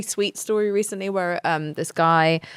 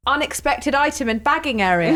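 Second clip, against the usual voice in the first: self-checkout voice; 6 semitones higher than usual. Retold or imitated. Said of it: "in a very, like".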